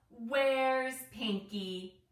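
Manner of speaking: slow, loud alarmed question; startled question. A narrator's reading. Zookeeper notices a missing bird, slow loud alarmed question. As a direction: drag it out in dismay.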